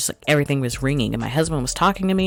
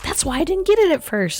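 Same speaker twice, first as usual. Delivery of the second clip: sudden realisation. Retold or imitated. Realising at the movie.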